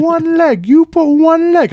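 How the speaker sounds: very high, drawn-out mock scolding in an accent; mimicked yelling. Massage worker telling off her customer, in high mock yelling. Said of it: "the last time I got yelled at".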